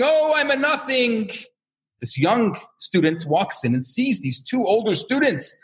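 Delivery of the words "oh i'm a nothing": higher, hammed-up cry of humility; a retold yeshiva student's mock wail; mock scream. Yeshiva student screaming across the study hall, mock wail. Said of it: "the other one is screaming".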